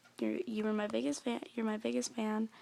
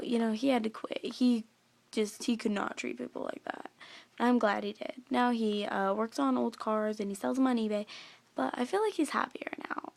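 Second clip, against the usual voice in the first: ordinary delivery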